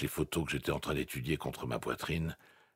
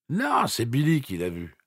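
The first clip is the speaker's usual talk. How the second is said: put-on drunk voice; 9 semitones higher, 5 dB louder than usual. A narrator's reading. Bob answers on phone.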